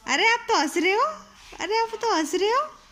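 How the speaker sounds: a mother cheering as her crying daughter starts laughing; delighted cooing. Real, not acted. Mother coos at laughing child.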